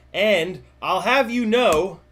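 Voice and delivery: slow, pompous "have you know"; mock haughty. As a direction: put on a grand air. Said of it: "I've always wanted to say".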